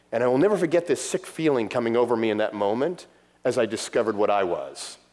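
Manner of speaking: normal talking voice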